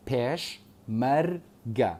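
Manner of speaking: پێشمەرگە broken slowly into three syllables. Shown as a demonstration. Teacher counting syllables for students, پێشمەرگە split slowly into its three syllables. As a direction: say پێشمەرگە as پێش، مەر، گە with gaps. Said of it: "ئەمە سێ بڕگەیە".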